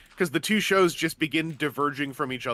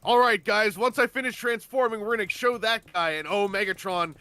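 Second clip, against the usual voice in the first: hammed-up Optimus; 6 semitones higher than usual. Mocking Optimus talking through his super mode sequence, hammed up. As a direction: ham up a heroic transformation speech. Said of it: "So he goes like".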